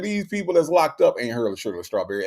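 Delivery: usual speaking voice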